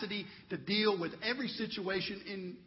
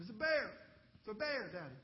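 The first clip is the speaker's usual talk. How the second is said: put-on soft, higher little-boy whimper; whimpering child; 4 semitones higher, 5 dB quieter than usual. Son telling daddy his bad dream, whimpering. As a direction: imitate a scared child confiding quietly. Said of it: "He whimpers back and says".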